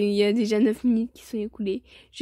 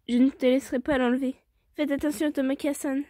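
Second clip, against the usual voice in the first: usual speaking voice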